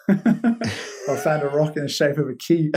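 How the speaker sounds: slow, laughing through the line; can't keep a straight face; giggling through a punchline. Retold or imitated. Retelling Ollie's joke while laughing.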